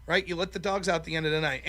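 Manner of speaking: ordinary talk, nothing marked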